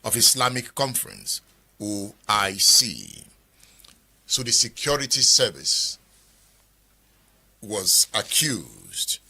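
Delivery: slow, deliberate presenter delivery; formal read-out. Acted. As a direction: spell it out slowly, pausing between phrases. Presenter reads and sums up.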